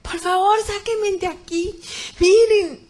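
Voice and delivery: high, loud, drawn-out plea; desperate shout. Retold or imitated. Woman trapped in the pit begs for rescue, desperate shout. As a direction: cry out to be pulled out. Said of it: "Empecé a gritar desesperada".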